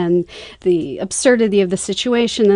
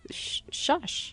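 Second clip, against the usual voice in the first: imitating a lecturer's unvoiced shush; whispered shushing; 7 semitones higher, 15 dB quieter than usual. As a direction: hush the gigglers under your breath. Acts speaker hushing the gigglers.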